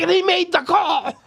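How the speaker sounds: losing control. Retold or imitated. A dealer losing his composure at the table, high excited outburst. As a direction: yelp it out as the call comes in. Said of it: "And I lost control".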